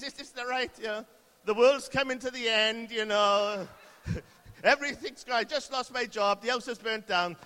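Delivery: high, loud, hammed-up crisis babble; mock panic. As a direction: play a flustered Brit comically falling apart. Comic Briton babbling in a crisis.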